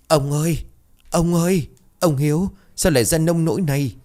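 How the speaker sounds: high wailing lament, crying out to the dead husband. A narrator's reading. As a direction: cry out in grief, voice pitched high. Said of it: "khóc giống cả lên". (gào khóc thảm thiết)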